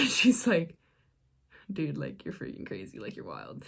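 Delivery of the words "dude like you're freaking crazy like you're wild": very soft, under the breath; hushed quoted reaction. Retold or imitated. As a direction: drop to a low near-whisper for the friend's words. Friend quoted reacting at kitchen table.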